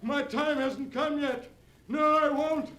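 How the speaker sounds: very high, building to a shrieking scream; wild terror. Acted. Terrified uncle screams as the ghost comes through the window. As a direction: lose all control, screaming in panic. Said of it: "voice grew wilder and wilder".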